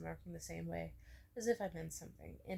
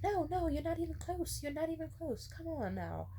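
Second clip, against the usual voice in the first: insistent reassurance; 11 semitones higher, 3 dB louder than usual. Retold or imitated. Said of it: "I was like".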